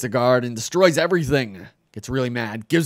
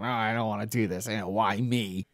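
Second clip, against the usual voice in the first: pissy whining; 5 dB quieter than usual. Retold or imitated. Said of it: "get all, like, pissy".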